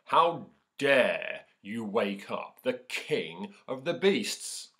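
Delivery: loud, slow roar of a lion; the lion's demand. Acted. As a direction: boom it out like the king of beasts. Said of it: "and said".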